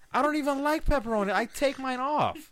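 high, loud ranting complaint; excited rant. Real, not acted. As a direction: rant it, really worked up. Pepperoni rant.

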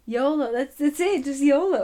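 loud and bright, cheering it; excited shout. Real, not acted. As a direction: call it out excitedly.

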